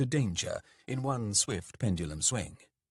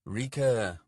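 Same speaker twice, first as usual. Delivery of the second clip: plain reading voice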